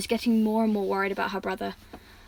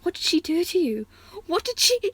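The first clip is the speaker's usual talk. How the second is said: choked anger; 8 semitones higher than usual. Acted. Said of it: "Anger choked her words".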